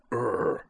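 short rolled mechanical growl; wordless trilled grunt; little robot growl. A narrator's reading. Tiny Robo offers to protect Utena, little robot growl. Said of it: "said Tiny Robo".

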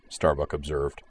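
plain reading voice

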